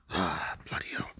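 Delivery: whispered curse under his breath; frustrated whisper. Real, not acted. Preacher searching for his Bible, whispering a frustrated curse. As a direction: hiss it to yourself, annoyed. Can't find his sword.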